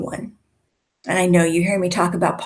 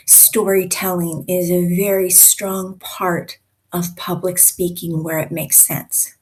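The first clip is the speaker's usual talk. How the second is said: ordinary talk, nothing marked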